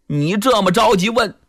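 ordinary delivery

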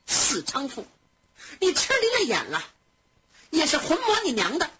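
scolding while trying not to laugh; laughing curse. A narrator's reading. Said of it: "凤姐儿也禁不住笑着骂". (笑着骂，声调偏高)

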